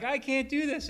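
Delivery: high despairing cry. Retold or imitated. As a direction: break out in a high plea. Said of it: "And you cry out".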